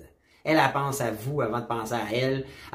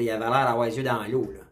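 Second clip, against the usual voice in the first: ordinary talk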